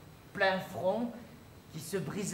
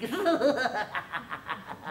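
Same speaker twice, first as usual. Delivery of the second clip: a devilish laugh rolling out; mephistophelean laugh; 3 semitones higher than usual. Acted. The snake waiting for the fox, devilish laugh. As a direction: cackle like the devil himself. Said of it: "en riant d'un rire méphistophélique".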